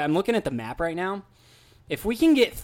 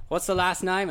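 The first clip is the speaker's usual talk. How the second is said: imitated British hotel concierge; amateur British accent on a retold question; bad British accent. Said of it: "how bad my accent is".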